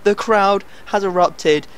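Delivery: slow, drawn-out hammed-up commentary; mock commentator. Player narrating his own goal as a mock commentator. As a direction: call it like a TV commentator.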